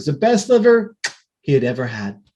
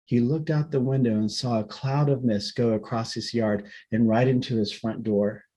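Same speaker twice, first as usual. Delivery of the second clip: plain reading voice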